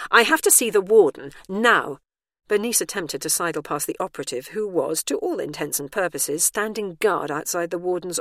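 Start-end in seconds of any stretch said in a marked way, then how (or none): 0.1-2.0 loud, higher-pitched demand; insistent demand. A narrator's reading. Bernice demands to see the warden, loud and insistent. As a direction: demand it at once, loudly.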